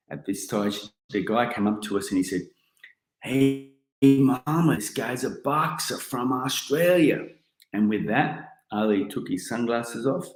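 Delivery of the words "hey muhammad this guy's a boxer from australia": higher, slow announcing call; organiser announcing. Retold or imitated. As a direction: present the boxer to the champ. Organiser presents boxer to Ali.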